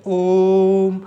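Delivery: ओम with the ओ held long and slow; drawn-out om. Shown as a demonstration. Teacher demonstrating a stretched vowel, long ओम. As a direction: chant ओम, stretching the ओ. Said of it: "ओ को खींचते हैं".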